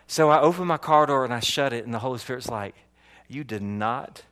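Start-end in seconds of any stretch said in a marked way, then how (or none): 3.3-4.2 low, soft, deliberate reproach; quiet rebuke. Retold or imitated. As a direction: voice the Spirit's hushed correction. Holy Spirit rebukes him in story.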